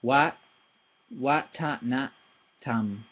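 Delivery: watanatam, every syllable slow and even. Shown as a demonstration. Teacher sounding out a Thai word, syllable by syllable. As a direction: sound out each syllable evenly. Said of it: "every syllable is the same".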